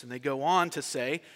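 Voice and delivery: normal talking voice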